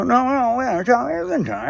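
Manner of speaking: very high, loud garbled pleading; mock coked-up babble. Passenger begs Mikey to tell dream, high garbled pleading. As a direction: squeal through words that won't form. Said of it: "I couldn't speak".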